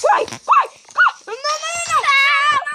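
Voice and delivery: high, loud panicked yells; frantic yelling. Real, not acted. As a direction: shout in panic at the screen. Yelling during a fall.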